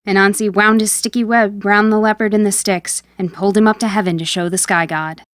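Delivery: ordinary delivery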